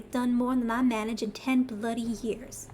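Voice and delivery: put-on lightly drawled Southern accent read in for a Cockney character; a Southern accent standing in for Cockney, held lightly; Southern twang. Streamer voicing the bitter old schemer Glommet, Southern accent. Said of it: "the heaviest Southern twang that I can pull off".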